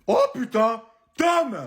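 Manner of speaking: shrieking high and loud with excitement; excited shriek. Acted. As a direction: scream for her son, thrilled. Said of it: "Pourquoi tu cries comme ça". (cri surexcité, très aigu)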